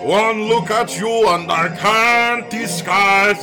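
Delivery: normal talking voice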